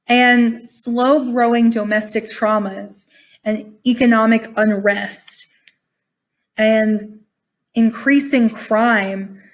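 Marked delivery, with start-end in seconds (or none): none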